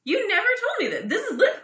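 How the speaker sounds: high-pitched disbelief; surprised. Real, not acted. Learning new things about an old friend, surprised. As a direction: exclaim it high, astonished.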